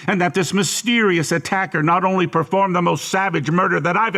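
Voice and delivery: high-pitched, incredulous tirade, rising steadily; mounting accusation. Acted. Interrogator builds an incredulous accusation.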